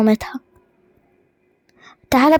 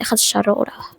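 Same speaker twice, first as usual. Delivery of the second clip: softer whispered blessing; 6 semitones lower, 5 dB quieter than usual. Acted. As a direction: breathe the words like a quiet charm. (همس هادي)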